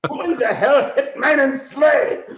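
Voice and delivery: high, loud, furious bellow; mock-outraged Santa shouting; angry Santa. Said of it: "He goes".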